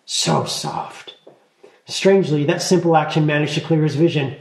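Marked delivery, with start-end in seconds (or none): 0.1-1.1 breathy whispered "so soft". A narrator's reading. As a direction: whisper it as if to herself. Talia pokes his stomach.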